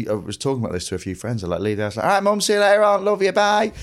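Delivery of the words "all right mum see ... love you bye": high, bright cheery farewell to mum; sing-song schoolboy bye to his mum; cheery goodbye. Retold or imitated. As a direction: chirp a happy goodbye on the way out.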